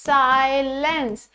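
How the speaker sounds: Silence drawn out slowly with a clear s sound; slow clear demo. Shown as a demonstration. Teacher to students, modelling silence slowly. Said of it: "तो मैंने क्या बोला".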